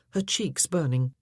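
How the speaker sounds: plain reading voice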